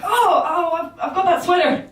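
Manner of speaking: a flustered, awkward gush; awkward nervous Simon Amstel. Retold or imitated. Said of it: "very awkward and nervous like this".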